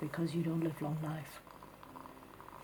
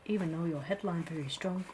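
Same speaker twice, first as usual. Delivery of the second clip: usual speaking voice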